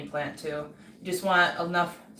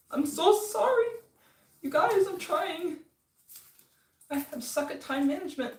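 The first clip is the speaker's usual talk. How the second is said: whiny apology; 10 semitones higher than usual. Real, not acted. Apologizing to viewers, high voice.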